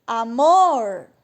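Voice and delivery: loud, drawn-out call of "Amor", swelling on "mor". Shown as a demonstration. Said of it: "vai ter que chamar no portão".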